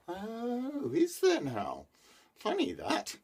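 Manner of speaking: high, dry disbelieving reply; sceptical. A narrator's reading. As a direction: humour the son's wild tale. Father doubts son's story.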